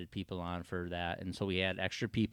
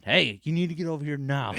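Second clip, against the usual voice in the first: loud, higher urgent radio call; 7 semitones higher, 10 dB louder than usual. Retold or imitated. Chad calling over the radio, loud and urgent. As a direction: summon him fast.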